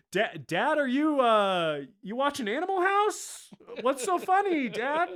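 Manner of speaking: high, hesitant son calling out; hammed-up clueless kid; mock puzzled son. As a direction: ask dad innocently, voice climbing high. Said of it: "It's like".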